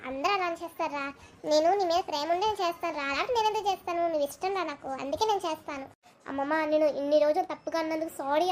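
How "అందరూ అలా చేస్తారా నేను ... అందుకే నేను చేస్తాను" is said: a lightly voiced grandma, soft and low; grandma consoling. Grandma softly tells her grandson she loves him. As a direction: hush him gently like an old granny.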